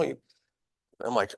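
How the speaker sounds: normal talking voice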